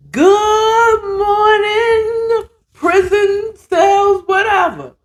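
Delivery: high, drawn-out radio-style wake-up call; Petey Green. Acted. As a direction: sing out the morning greeting, stretched way out. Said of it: "uh, Petey Green. Be doing a".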